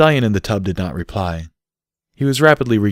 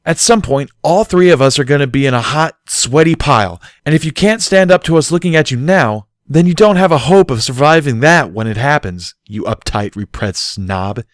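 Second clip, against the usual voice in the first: higher, louder scolding; blunt teasing reproach; forceful lecture; 7 semitones higher, 7 dB louder than usual. A narrator's reading. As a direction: hammer the point home. Barry lecturing Bucky.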